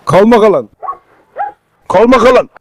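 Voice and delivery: loud, insistent order to settle down; firm loud command. Real, not acted. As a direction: project firmly at an agitated dog. Pinakakalma ang asong nagwawala. (malakas at madiing pag-awat)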